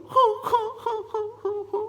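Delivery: high, drawn-out fake sobs; theatrical crying sound; mock sobbing. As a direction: wail like a weeping queen, hammed up. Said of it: "에스더가 거기서 막 우는 거예요".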